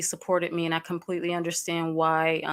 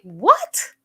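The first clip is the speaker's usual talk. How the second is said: a sharp, higher, louder "What?"; shocked what; 4 semitones higher, 6 dB louder than usual. Retold or imitated. Reacting to the troll's message, a shocked higher loud "What?". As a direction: blurt it out in disbelief. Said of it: "Like, I was like".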